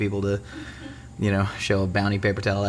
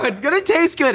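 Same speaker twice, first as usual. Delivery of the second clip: ad pitch. Pretend.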